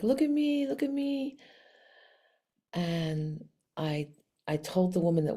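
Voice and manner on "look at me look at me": lightly voiced high cat plea; a hint of a meow, pitched up; needy kitty calling for attention, held high. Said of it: "like, meowing".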